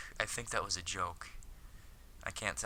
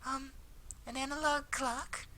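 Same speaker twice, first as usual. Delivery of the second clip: puzzled anime schoolgirl. Acted. Akiko queries Hikaru's odd remark, high puzzled voice. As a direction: echo it high and girlish, baffled.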